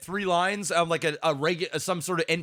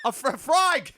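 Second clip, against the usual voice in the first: much higher Aussie yell breaking into laughter; laughing Aussie accent shout; 9 semitones higher, much slower than usual. Pretend. Repeating the frag line for his co-host, laughing Aussie accent. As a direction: keep yelling frag in the Aussie voice while cracking up. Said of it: "use that accent".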